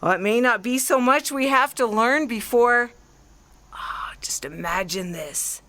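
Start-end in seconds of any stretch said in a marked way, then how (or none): 3.7-5.6 breathy secret whisper, slowed down; hushed whisper. Acted. Artilleryman confides his wild plan to the stranger, whispering. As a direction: lean in and whisper the dream like a secret.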